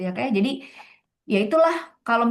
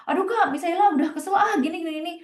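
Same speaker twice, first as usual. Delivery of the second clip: higher, fast, frazzled tumbling words; flustered outburst; 7 semitones higher, faster than usual. Retold or imitated. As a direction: blurt it out, fed up and rattled. Exploding after bottled anger.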